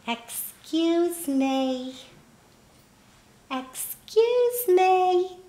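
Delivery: put-on slow, drawn-out little old lady. Old woman to crying stranger, little drawn-out voice. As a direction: call out high and slowly, stretching each word. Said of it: "I heard this little voice".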